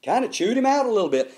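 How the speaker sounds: ordinary talk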